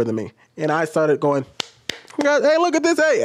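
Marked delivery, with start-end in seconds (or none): 2.2-3.2 high call-out to a whole room; mock public shout, pitched way up; re-enacted yell. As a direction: holler for everyone's attention, acted out. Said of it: "I literally yelled out in the restaurant".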